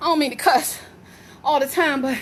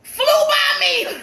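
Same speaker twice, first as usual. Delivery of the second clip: high, drawn-out squeal of alarm; panicked outcry; 9 semitones higher, slower than usual. Real, not acted. Reliving the bird's fly-by.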